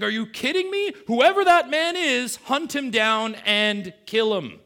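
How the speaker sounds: very high, loud furious outburst; outraged. Retold or imitated. Preacher voicing David shouting in outrage. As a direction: demand the man be hunted down. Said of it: "David is beside himself".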